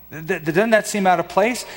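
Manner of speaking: normal talking voice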